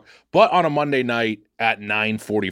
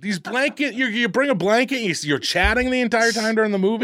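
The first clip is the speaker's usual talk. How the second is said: indignant; 11 semitones higher than usual. Real, not acted. Ranting about chatting blanket people.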